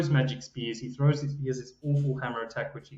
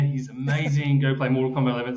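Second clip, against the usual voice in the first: ordinary talk, nothing marked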